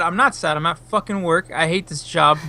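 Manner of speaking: usual speaking voice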